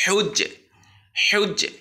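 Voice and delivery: হেউজ্জে chanted four times, high and loud, each with a falling tune; recitation drill. Shown as a demonstration. Teacher drills the syllable for students, clear recitation. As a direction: model each repetition. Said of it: "একারের উচ্চারণ একটু".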